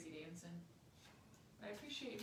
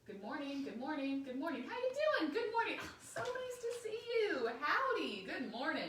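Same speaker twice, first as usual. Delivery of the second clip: higher, louder, ever more eager greetings; cheery greetings; 7 semitones higher, 6 dB louder than usual. Pretend. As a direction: greet each ant brighter and brighter. Greeting six hundred ants.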